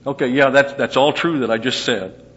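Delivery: loud, high, eager Paul; emphatic preacher paraphrase; animated paraphrase. Retold or imitated. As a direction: voice Paul's point with gusto. Voicing Paul's argument.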